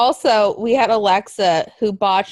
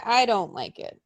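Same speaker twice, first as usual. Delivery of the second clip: usual speaking voice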